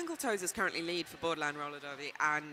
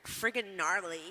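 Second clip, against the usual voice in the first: put-on American teenager accent. Shown as a demonstration. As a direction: say it like a Californian teen. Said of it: "give it to me again".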